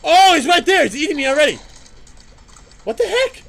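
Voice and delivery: high, loud startled cries; panicked yell. Real, not acted. Gamer caught by a boss, panicked high yell. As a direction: yelp as he jumps out at you.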